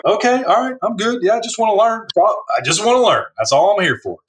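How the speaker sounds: high, eager-to-please reassurance; meek placating. Retold or imitated. New hire reassuring the stern boss, high and eager. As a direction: backpedal fast so the boss stays calm.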